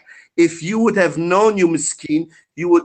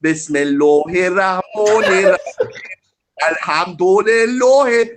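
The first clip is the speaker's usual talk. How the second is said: mock Persian recitation; 3 dB louder than usual. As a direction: parody a Persian-accented Quran reciter. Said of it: "how you zanadiqa read the Quran".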